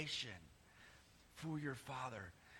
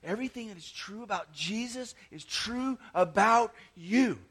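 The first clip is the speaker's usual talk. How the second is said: louder, driving every word home; emphatic repeat; 7 semitones higher, 8 dB louder than usual. Shown as a demonstration. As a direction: say it again so nobody can miss it. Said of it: "Do you hear that?".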